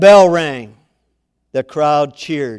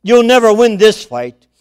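higher, louder furious threat; Satan screams; 6 semitones higher, 9 dB louder than usual. Acted. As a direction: yell it at Christ. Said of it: "Satan screamed".

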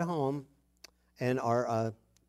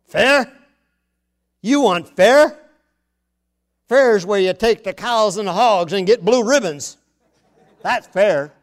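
louder, higher mock rant on fair; mock dad rant; 4 semitones higher, 9 dB louder than usual. Father scolding a child who whines about fairness, loud mock rant. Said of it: "I thought of Charles".

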